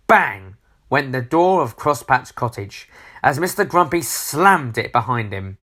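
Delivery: ordinary delivery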